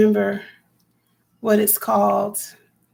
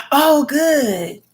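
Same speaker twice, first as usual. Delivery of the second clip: surprised gasp; 5 semitones higher, 4 dB louder than usual. Real, not acted. Gasps at chat news.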